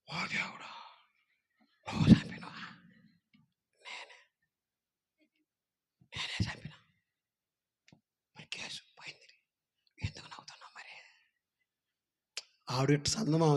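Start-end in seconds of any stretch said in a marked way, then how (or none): 0.1-4.2 hushed whisper; whispered shock. Acted. Wife whispers in shock at his confession. 6.1-6.8 softly whispered; whispered confession. Acted. Husband whispers his confession to wife. 8.4-10.9 hushed whisper; whispered shock. Acted. Wife whispers in shock at his confession.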